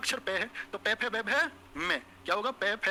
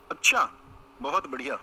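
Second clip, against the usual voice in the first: mock surprise; 7 semitones lower, 6 dB louder than usual. Real, not acted. Teacher mocking a student's answer with loud surprise. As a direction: feign amazement at the answer. Reacting to the girl's line.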